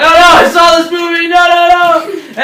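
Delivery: loud, high-pitched wail of dread; mock panic. Castaways recognising the shark movie's music, mock panic. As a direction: cry out in hammed-up terror, drawing out the noes.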